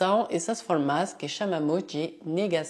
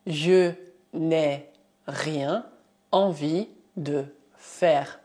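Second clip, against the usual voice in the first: "je n'ai rien envie de faire" in slow chunks; teacher dictating slowly; slow dictation; slower than usual. Shown as a demonstration.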